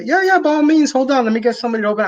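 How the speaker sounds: hammed-up helpful clerk; imitating the clerk offering to open the box; mock store clerk. Store clerk voiced in story.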